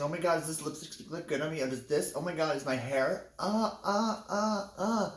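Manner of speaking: put-on high, excited girly chatter, squealing at the end; imitating the girls. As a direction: gush like a teen girl over her lipstick. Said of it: "all the girls started asking".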